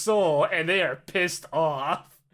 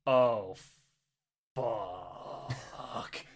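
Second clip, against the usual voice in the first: despairing groan; 9 semitones lower, 8 dB quieter than usual. Retold or imitated. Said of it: "Pong says".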